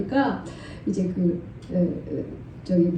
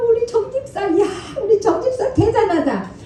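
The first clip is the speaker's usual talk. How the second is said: high, laughing praise; gushing delight; 12 semitones higher than usual. Retold or imitated. Pastor gushing over the humble deacon, delighted and laughing. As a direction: gush over her like a proud mother.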